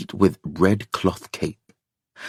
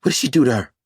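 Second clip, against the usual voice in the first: alarmed question; 5 semitones higher, much faster than usual. A narrator's reading. Katie alarmed about Ruth.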